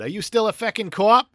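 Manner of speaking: a hammed-up English copper's snarl; English cop accent. Podcast host quoting the English cop in a mock English accent. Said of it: "the English cop said".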